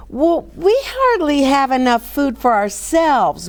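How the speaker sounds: high, complaining moan. A narrator's reading. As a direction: whine it like there's never enough.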